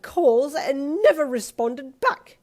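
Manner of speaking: loud, high, drawn-out haughty accusation; snapping. A narrator's reading. Miss Marple berates Pa for ignored calls, snapping. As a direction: hammer each grievance at full voice. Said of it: "she snapped".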